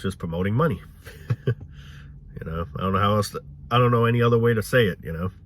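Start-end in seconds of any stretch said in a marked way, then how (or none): none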